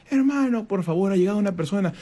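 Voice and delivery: high shouted call, urgent but friendly; calling out loud. Retold or imitated. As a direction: call down loudly to a brother that someone has arrived. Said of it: "le gritaba".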